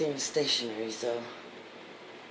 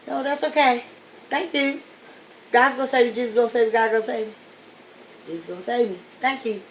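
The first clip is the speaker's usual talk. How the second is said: higher, louder, breezy refusal; cheerful stubborn believer; 7 semitones higher, 7 dB louder, faster than usual. Acted. Drowning man politely turning down the helicopter, breezy and high. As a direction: wave off the rescue, sure God will come.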